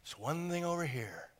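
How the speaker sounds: God quietly forbids one thing; hushed warning. Retold or imitated. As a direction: drop to a near hush. Hushed contrast.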